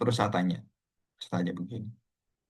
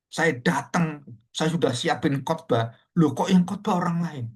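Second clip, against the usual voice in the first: higher, aggrieved account; retold indignant protest; 5 semitones higher, 4 dB louder than usual. Elder protests to pastor about being replaced, mock indignant. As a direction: act out an elder's puzzled indignation.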